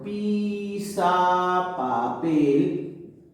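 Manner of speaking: "Pisa, papel" stretched slowly, almost chanted; drawn-out syllables. Shown as a demonstration. Teacher showing how the compound will sound, drawn-out syllables. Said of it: "va a ser así".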